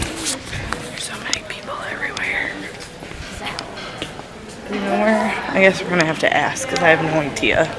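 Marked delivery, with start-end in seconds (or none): 0.1-2.5 hushed whisper. Real, not acted. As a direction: whisper so people nearby can't hear. Whispering in crowded store.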